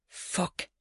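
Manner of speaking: hushed, voiceless curse; quietly. A narrator's reading. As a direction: swear softly under your breath. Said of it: "siger hun stille".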